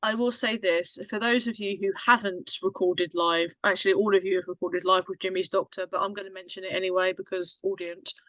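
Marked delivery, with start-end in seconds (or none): none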